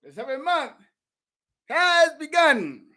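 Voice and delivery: high, loud ringing proclamation; preaching proclamation. Acted. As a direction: call it out like a herald to the congregation. Proclaims the holy day.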